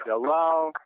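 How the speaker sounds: ordinary talk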